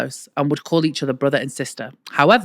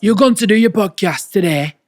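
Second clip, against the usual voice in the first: northern dad; 4 semitones higher, 6 dB louder than usual. Retold or imitated. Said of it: "pretend to be my dad".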